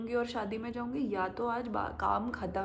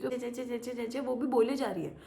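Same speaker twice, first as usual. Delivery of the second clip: ordinary talk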